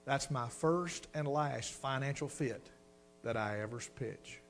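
hushed earnest prayer; solemn vow to God. Retold or imitated. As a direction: promise the Lord quietly and sincerely. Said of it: "I told the Lord".

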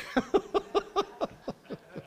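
high, rapid chuckling laugh; tickled laugh. Real, not acted. Preacher laughing at his nursery door joke, tickled. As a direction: burst into a high-pitched giggle. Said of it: "I got tickled one time".

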